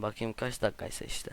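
a soft whisper to himself; whispered aside. Real, not acted. Gamer whispers to himself while checking the video length. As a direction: murmur it under your breath while checking.

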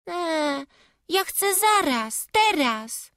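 put-on petulant child, pitched high; demanding it now, lightly voiced child; whiny little girl. Little girl begging her father to go now, whiny.

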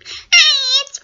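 high, loud squeal cut short; Fred. Retold or imitated. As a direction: start a squeaky greeting and give up. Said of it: "the one that everybody knows".

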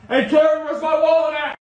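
loud, high call through the house; yelling. Acted. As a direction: shout for your wife from another room.

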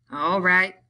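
no-nonsense, brusque. A narrator's reading. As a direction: say it curtly, all business. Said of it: "he said brusquely".

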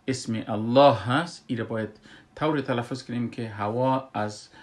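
ordinary delivery

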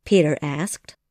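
plain reading voice

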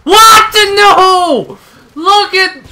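high, loud "What? No!"; startled shout. Real, not acted. Gamer shouting as he is suddenly killed, startled yell.

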